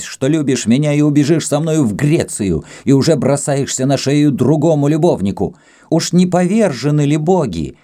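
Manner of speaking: high, heated jealous outburst; enraged tirade. A narrator's reading. Jealous Greek accuses Kama of betrayal, enraged. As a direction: pour out indignant accusations. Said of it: "закричал грек".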